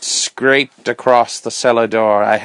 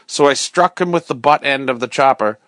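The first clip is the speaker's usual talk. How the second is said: usual speaking voice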